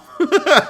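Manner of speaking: loud, high-pitched burst of laughter; bursting out laughing; real laugh. Real, not acted. Commentator cracking up at the mugshots joke, laughing.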